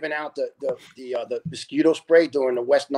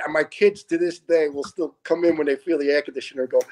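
usual speaking voice

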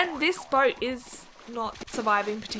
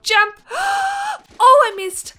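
high sharp gasp then louder dismay; startled gasp; 11 semitones higher, 9 dB louder than usual. Real, not acted. Gamer missing the rescue boat jump, startled gasp. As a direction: leap and gasp as the jump fails.

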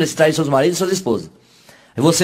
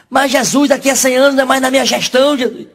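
high, fast complaining plea; the president protests to Jesus in a high voice; a hammed-up indignant whine; whiny protest; 9 semitones higher than usual.

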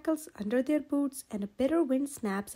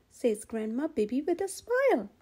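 plain reading voice

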